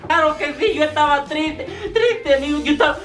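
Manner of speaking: the excited friend rattles on; high friend voice. Acted. Sketch friend tells his plan. (el amigo cuenta su plan con voz aguda)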